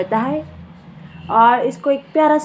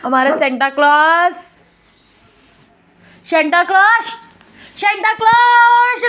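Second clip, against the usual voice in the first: rising calls, last one much higher and slow; sing-song calling; 7 semitones higher, 4 dB louder than usual. Real, not acted. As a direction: call out playfully, stretching clause. (लाड़ से खींचकर "Santa Claus" पुकारना)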